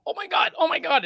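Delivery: high-pitched mock panic; retold panic. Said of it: "I'm fucking panicking".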